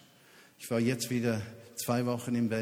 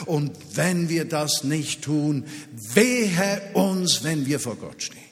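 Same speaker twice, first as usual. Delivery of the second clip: sudden forceful cry of woe; stern warning; 3 semitones higher, 5 dB louder than usual. Acted. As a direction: thunder the warning from the pulpit. Preacher warning the congregation.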